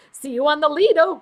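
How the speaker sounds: light upbeat quip, quick; quoting a friend's quip. Retold or imitated. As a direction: toss off the catchphrase briskly. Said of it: "He always has all the quips".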